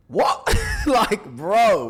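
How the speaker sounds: high, loud shout of disbelief breaking into a laugh; shouted what. Real, not acted. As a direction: yell it, then crack up laughing. Reacting to the fake reply.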